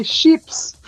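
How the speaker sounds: "sheeps" with sh for ch and long ee; slow, teasing "sheeps", rising at the end; mispronounced chips. Shown as a demonstration. Vlogger contrasting crisps with local word, a drawn-out "sheeps". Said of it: "We say it like this".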